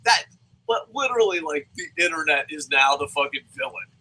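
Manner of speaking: normal talking voice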